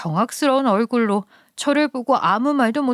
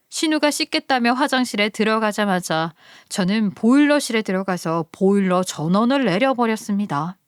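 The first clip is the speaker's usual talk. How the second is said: ordinary delivery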